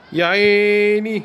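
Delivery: يا عيني long and high; drawn-out high admiring cry. Real, not acted. Admiring a save.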